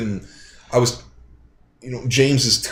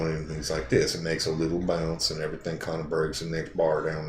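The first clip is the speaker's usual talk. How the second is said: put-on Texas accent; 5 semitones lower, 6 dB quieter than usual. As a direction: imitate a Texas accent, words leaning together. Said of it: "That's a Texas accent".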